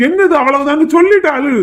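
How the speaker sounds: loud, high-pitched demand; exasperated. Acted. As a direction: insist on an explanation at top voice. Exasperated at the bride's vague crying. (எரிச்சலோடு கத்தல்)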